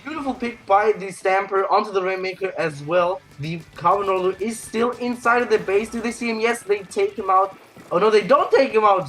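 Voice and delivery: high-pitched esports call; excited play-by-play. Real, not acted.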